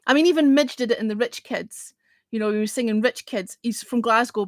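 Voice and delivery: ordinary delivery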